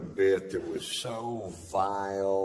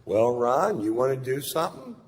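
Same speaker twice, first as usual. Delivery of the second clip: inviting host; 6 semitones higher, 6 dB louder than usual. Retold or imitated. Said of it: "and Doc goes".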